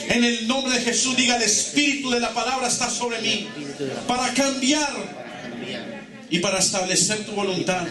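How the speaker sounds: fervent preaching. Acted. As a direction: lead the congregation in bold declarations.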